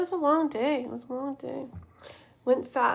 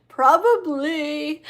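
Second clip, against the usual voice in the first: laughing; 4 semitones higher, 5 dB louder, much slower than usual. Real, not acted. Teasing admission, laughing.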